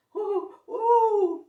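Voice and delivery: high, loud, slow ghostly hooting; strange hoo-hooing. Acted. Pumpkin-headed Splat spooking the class with hoots. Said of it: "made strange hoo-hooing noises".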